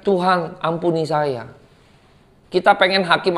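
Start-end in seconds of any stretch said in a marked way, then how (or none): 0.1-1.6 softer, slower imitated begging; mock plea for mercy. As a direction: beg the judge quietly. Victim begs God for mercy.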